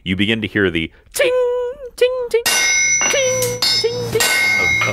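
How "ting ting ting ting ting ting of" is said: metal ting. Acted. DM imitates forging hammer sounds.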